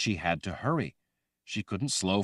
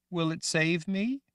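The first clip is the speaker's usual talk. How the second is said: put-on childlike Rachel; 11 semitones higher than usual. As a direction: sound like a scared little girl.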